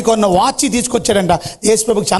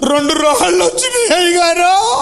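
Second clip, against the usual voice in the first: put-on high, slow sobbing boy; crying child; 10 semitones higher than usual. A narrator's reading. Boy sobs to pastor about the stones. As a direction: blubber it out like a hurt child. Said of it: "అని ఏడుస్తున్నాడు".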